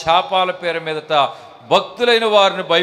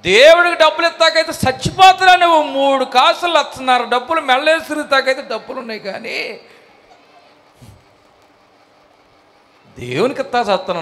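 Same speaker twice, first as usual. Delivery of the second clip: mock scolding pastor; 8 semitones higher than usual. Pastor scolding a believer for a stingy offering, mock angry.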